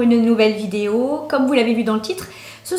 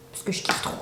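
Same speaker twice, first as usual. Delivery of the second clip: near-silent whisper of pleasure; whispered confession of love; whispered delight; 9 dB quieter than usual. Real, not acted. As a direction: whisper it like a guilty secret. Confides her delight.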